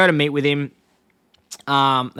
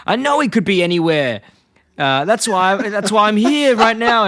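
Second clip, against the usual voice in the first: mock exasperation; 7 semitones higher, 4 dB louder than usual. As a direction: pitch climbing, growing louder. Homer retold arguing with director.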